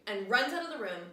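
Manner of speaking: ordinary talk, nothing marked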